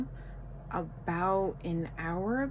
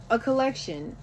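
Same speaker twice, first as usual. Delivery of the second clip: normal talking voice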